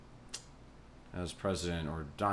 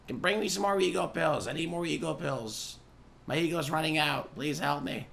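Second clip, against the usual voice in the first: higher, whiny begging; begging his wife for more ego pills; mock whiny plea; 8 semitones higher than usual. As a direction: whine like a needy child out of pills.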